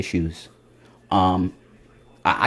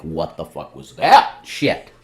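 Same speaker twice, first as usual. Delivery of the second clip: stunned; 5 semitones lower, much slower than usual. Retold or imitated. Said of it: "your mind is like".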